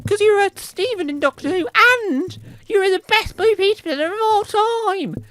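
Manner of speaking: very high, loud fan squeal; squeaky fans. Acted. Fans asking presenter for autograph, squeaky put-on squeal. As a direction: squeal it like overexcited mock fans.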